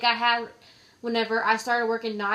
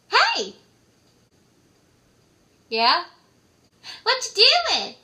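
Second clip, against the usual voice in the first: much higher and louder, a chirpy pestering friend; bright nagging caller who woke you; 11 semitones higher, 6 dB louder, slower than usual. Retold or imitated. Friend calling midday, pestering in a high loud voice.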